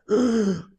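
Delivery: high, mock can't-breathe crying gasp; breathless sobbing gasp. Shown as a demonstration. Showing how hard the crying was, breathless sobbing gasp. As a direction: demonstrate a gasping sob. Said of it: "can't even breathe crying".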